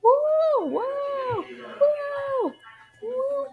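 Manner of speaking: very high joyful woo; gleeful whoops. Acted. Kids playing in the ball pit, gleeful whoops. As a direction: whoop with delight. Kids cheering in play place.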